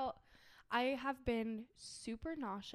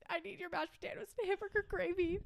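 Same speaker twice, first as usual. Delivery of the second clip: higher-pitched mock-tearful plea; retold sobbing; 7 semitones higher, faster than usual. Retelling her tearful call to dad, mock sobbing. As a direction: plead as if crying, lightly acted. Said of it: "I'm sobbing. And I'm just like".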